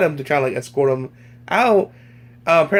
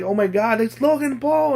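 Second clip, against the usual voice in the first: imitated excited fans; 9 semitones higher than usual. VidCon fans spotting their idol, imitated excitement. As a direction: pitch climbing high, mock fan excitement. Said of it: "people were like".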